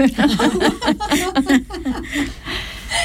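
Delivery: soft, high trailing laugh; real laughter. Real, not acted. Telling how teen classmates reacted, fading laugh. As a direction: laugh freely at your own story. Laughing at her own story.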